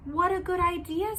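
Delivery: high, quick and eager; eager Zog voice; grateful young dragon. Acted. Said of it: "said Zog".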